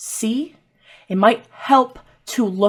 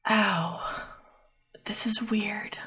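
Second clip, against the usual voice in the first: very soft, half-whispered discomfort; pained murmur; 3 semitones higher, 10 dB quieter, slower than usual. Acted. Possessed sister holding her forehead, pained murmur.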